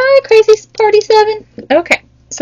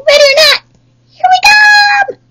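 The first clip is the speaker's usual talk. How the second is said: playful seeker's call. Pretend. Counting turtles announce they are coming: high playful call. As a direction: call out like a kid in hide and seek.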